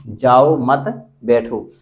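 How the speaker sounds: "जाओ मत" held together, then "बैठो" on its own; slow "जाओ मत", low "बैठो" after it; pause placement. Shown as a demonstration. Teacher reading the written example to students, pause after मत. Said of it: "अल्प विराम लगा है".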